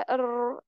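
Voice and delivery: अर्र trilled with many taps; trilled r demo; rolled r. Shown as a demonstration. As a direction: roll the r in अर्र. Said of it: "तकरार क्या होता है".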